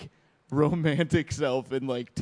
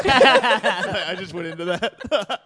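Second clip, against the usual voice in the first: laughing; 4 semitones higher, 6 dB louder, much slower than usual. Real, not acted. Laughing after song joke.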